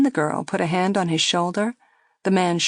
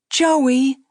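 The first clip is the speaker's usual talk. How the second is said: higher, louder coaxing plea; girl coaxing; 7 semitones higher, 5 dB louder than usual. A narrator's reading. Girl coaxing Joey to skip another drink. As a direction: gently press him to stop. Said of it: "the girl said".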